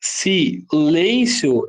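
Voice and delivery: "silêncio" slowly split as si - LÊN-cio; slow, chunked "silêncio" with a diphthong ending; syllable by syllable. Shown as a demonstration. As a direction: sound out "silêncio", final IO kept as one syllable. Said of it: "paroxítonas terminais em ditongo".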